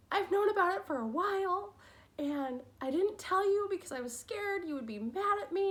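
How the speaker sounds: high, loud, tearful rush of words, lightly acted; retold crying confession. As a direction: replay confessing through tears, half-performed. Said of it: "just crying my eyes out".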